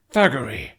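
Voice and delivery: loud, angry snap; a voice not his own, angry snap; snapped in a strange voice. A narrator's reading. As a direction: bark the name in rage. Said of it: "Hiccup snapped in a voice that was not his own".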